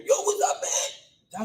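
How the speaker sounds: high, loud street greeting; big high-pitched holler, imitating; imitated yelled greeting. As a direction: mimic someone yelling a casual hello. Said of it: "they like to yell for everything".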